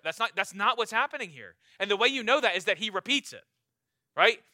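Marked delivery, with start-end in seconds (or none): none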